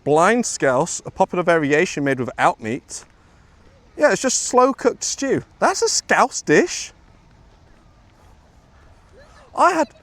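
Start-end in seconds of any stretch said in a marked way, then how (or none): 4.0-9.9 high, slow disbelief at a plain stew; drawn-out "really?" amazement; incredulous surprise. Real, not acted. Learning scouse is just stew.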